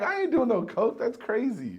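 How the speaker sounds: high, loud mock outrage; loud disbelieving no way; incredulous refusal. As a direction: refuse like it's absurd.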